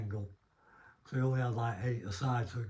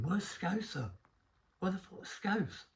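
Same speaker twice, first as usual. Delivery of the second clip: higher-pitched mock calls for someone missing; imitated calling out; 8 semitones higher, slower than usual. Waiting players ask for the Scouse lad, imitated calling out. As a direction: lightly act out men asking after a teammate, pitched up.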